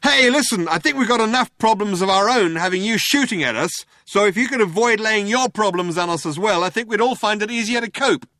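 high, loud shout across a distance; Ford shouting out. A narrator's reading. Ford to attackers who are shooting at them, shouting out. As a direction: shout it out, pitched well up. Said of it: "Ford shouted out".

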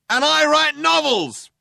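high, slow, loud call; shouting cop. A narrator's reading. Second cop chiming in about his writing, shouting. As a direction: chime in at a drawn-out shout.

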